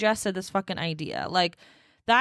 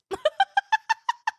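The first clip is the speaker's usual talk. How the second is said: sudden squeal of laughter, much higher; 4 dB louder than usual. Real, not acted. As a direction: let a high laugh burst out. Bursts out laughing.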